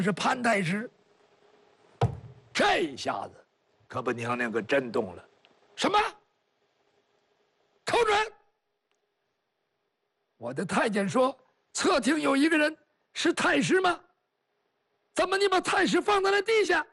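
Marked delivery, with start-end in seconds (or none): 5.7-16.9 a startled empress snapping for the truth; empress demanding answers. Acted. Empress confronting Kou Zhun, high and demanding.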